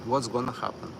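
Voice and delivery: usual speaking voice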